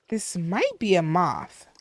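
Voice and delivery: ordinary delivery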